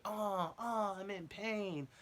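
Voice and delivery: high, slow drawn-out moan of pain; whiny injured guy. Retold or imitated. To his buddy, imitating the injured guy in a high slow moan. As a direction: ham up the hurt homeless guy. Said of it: "'cause he was like".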